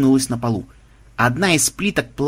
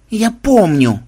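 groaning; 6 semitones higher, 4 dB louder, much slower than usual. A narrator's reading. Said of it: "простонала Мелисса".

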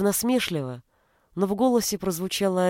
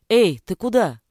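louder call after someone; 3 semitones higher, 7 dB louder than usual. A narrator's reading. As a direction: shout after her as she walks off. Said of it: "крикнул ей вслед Ежи".

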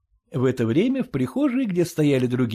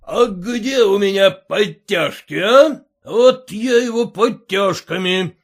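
slow, threatening outburst, loud on "а?"; angry shout; 7 semitones higher, 7 dB louder, slower than usual. A narrator's reading. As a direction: threaten the boy with the braces. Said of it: "закричал он".

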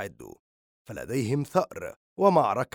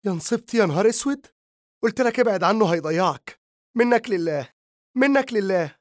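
much higher, louder lament; despairing wail; 7 semitones higher, 4 dB louder than usual. A narrator's reading. Mother's despairing outburst.

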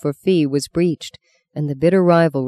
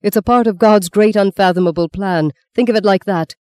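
raised, emphatic pleading; urgent persuasion; 4 semitones higher, 5 dB louder than usual. A narrator's reading. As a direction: press it on him earnestly. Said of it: "the priest cried, trying to pull him back".

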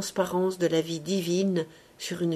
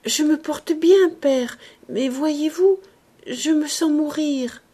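fragile pleading; 7 semitones higher, 5 dB louder than usual. A narrator's reading. Dying girl pleads with father.

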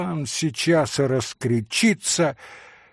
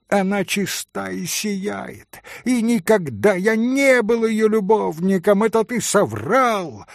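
furious defense; 7 semitones higher, 4 dB louder than usual. Acted. Митя защищает Грушеньку.

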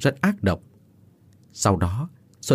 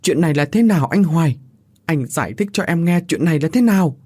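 higher-pitched, upset questioning; hurt, pleading voice; tearful; 6 semitones higher than usual. A narrator's reading. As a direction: demand an explanation through tears. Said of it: "chỉ biết khóc lóc, miệng khẽ run lên nói".

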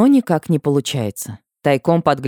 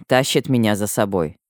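plain reading voice

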